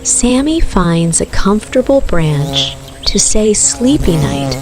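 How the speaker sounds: ordinary delivery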